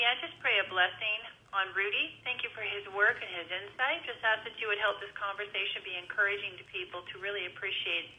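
a quiet, sincere opening prayer. Real, not acted. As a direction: pray gently before the interview begins. Opens the show with prayer.

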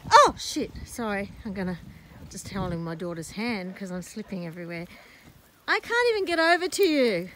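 ordinary talk

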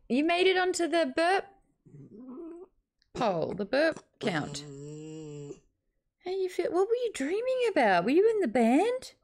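very high, sing-song pet voice; high cooing talk to a sleepy dog. Real, not acted. Baby talk to her dog.

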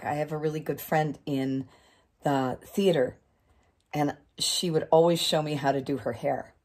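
ordinary delivery

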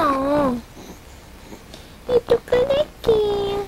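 drawn-out baby talk, mock grievance; whiny-playful pretend baby; complaining baby voice. Mother voices bald baby complaining.

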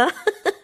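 a quick high giggle; short laugh. Real, not acted. Narrating her singing clip to viewers, a quick high giggle.

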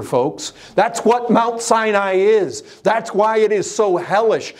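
full-force pulpit preaching; fiery preaching. Acted. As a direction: preach it high and hard to the congregation.

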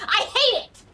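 loud high cry of hate; angry outburst. Real, not acted. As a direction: blurt it out in a loud tantrum. Outburst about her name.

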